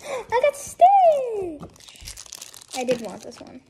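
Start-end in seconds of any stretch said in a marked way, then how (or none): 0.1-1.6 high, slow delighted cry; excited squeal. Real, not acted. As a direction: squeal with joy at the prize. Excited at getting Stitch.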